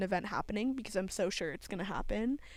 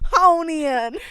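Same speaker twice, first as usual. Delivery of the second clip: loud mimic; 5 semitones higher, 12 dB louder than usual. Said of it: "You said".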